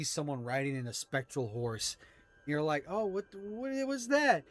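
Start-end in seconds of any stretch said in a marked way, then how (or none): 2.9-4.4 surprised. Acted. Players react to spectral horse.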